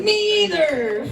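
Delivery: high, drawn-out comic reply; exaggerated long high wail in jest; mock commiseration. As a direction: stretch it out high, playing it up. Retelling own reply, played up.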